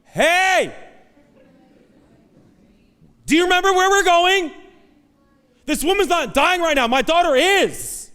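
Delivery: a father furious that his dying daughter must wait; frustrated father. Retold or imitated. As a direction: demand to know why Jesus stopped. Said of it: "Sitting there going".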